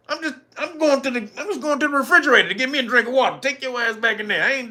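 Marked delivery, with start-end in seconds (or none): 0.1-3.4 fast mock excuse, rattled off; rushed excuse. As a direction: rush it out like sneaking off. Acted woman excusing herself.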